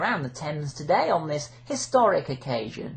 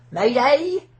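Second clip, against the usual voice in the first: rising mayday call; 8 semitones higher, 8 dB louder than usual. Acted.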